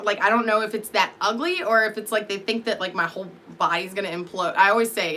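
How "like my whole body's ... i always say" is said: usual speaking voice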